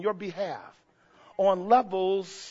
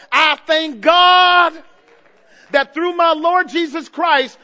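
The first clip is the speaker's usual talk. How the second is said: slow, loud, drawn-out shout; shouted thanks; 5 semitones higher, 8 dB louder, slower than usual. Real, not acted. Preacher thanking God before the church, slow and loud. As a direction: stretch it out and shout it to heaven.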